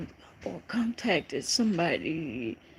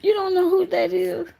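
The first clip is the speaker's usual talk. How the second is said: higher and louder, fast; can't believe they don't know her; thrilled who-is-that; excited disbelief; 10 semitones higher, 9 dB louder, faster than usual. Real, not acted. Excited at recognizing caller.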